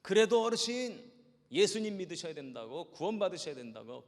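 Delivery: pastor calls out to the leaving elder, loud shout; shouting after him. Retold or imitated. Shouting at the old man's back, loud and pleading. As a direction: call it out at his back. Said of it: "소리 질러야지".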